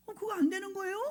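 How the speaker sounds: high-pitched mock-puzzled question; naive student. (순진하게 되묻는 학생 흉내)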